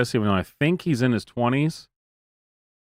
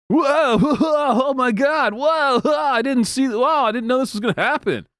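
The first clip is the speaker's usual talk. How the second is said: mock gasp; 13 semitones higher than usual. Host mocking overreactions to old news, hammed-up high gasps. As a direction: overact a fake surprise. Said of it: "the way people point it out shouldn't be".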